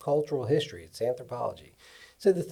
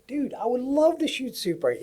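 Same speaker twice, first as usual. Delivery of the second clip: much higher-pitched, eager acceptance; retold excitement; 10 semitones higher than usual. Cameraman accepting the director's Super eight offer, retold with high-pitched excitement. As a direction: replay being thrilled by an offer. Retells his thrilled reply to director.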